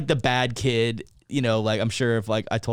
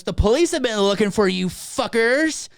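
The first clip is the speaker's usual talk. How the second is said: high, loud mock scolding; angry mom impression; 9 semitones higher, 5 dB louder than usual. Mom confronting the boys about the police, angry. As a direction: act out a furious mom.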